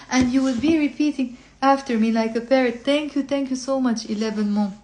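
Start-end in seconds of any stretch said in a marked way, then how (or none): none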